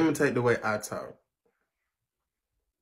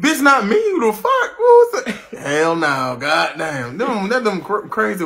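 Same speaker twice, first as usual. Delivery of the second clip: high, loud laughing outcry; outraged; 8 semitones higher, 11 dB louder, slower than usual. Real, not acted. Laughing outrage that anyone talks like that. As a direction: shriek in laughing disbelief.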